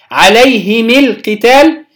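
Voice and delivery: عليهم القتال joined as "alayhimi l-qital"; kasra linking form. Shown as a demonstration. Teacher showing the second form, عليهم القتال as "alayhimi l-qital". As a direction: slowly link عليهم to القتال with short i. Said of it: "ثلاثة أوجه".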